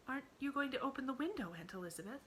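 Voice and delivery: put-on high, quick little girl voice; a child eagerly asking a favour; hopeful girl. A narrator's reading. Emily asks her aunt to open the window, high and quick. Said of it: "child asks aunt".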